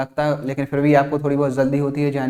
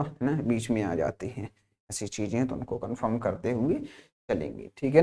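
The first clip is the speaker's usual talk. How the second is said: quiet aside; 4 semitones lower, 8 dB quieter than usual. Real, not acted. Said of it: "aside, much quieter".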